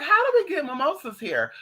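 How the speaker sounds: high, excited, incredulous question. Retold or imitated. Asking how mimosas grow locally, incredulous. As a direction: pitch it up high in amazement.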